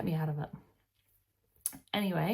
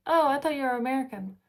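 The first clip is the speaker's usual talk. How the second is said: imitating a local; 7 semitones higher, 6 dB louder, faster than usual. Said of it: "And they're like".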